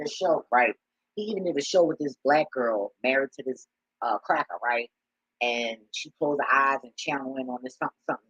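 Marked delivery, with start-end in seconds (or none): none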